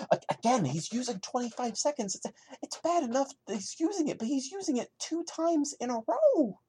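can't believe he did it again; exasperated rant. Real, not acted. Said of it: "as upset as I've sounded".

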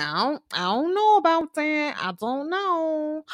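hesitant Blanca, unconvinced by Dre's story; Blanca impression. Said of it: "that's exactly how she said it".

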